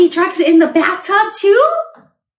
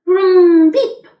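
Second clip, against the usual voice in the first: loud revving vroom then a high beep; 5 semitones higher, 6 dB louder than usual. Pretend. Reading to a child, a loud toy-truck vroom. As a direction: play the truck, rev then honk.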